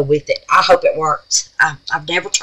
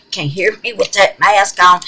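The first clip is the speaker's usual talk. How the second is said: laughs through the words; chuckling at herself; laughing. Real, not acted. Laughing about the mask.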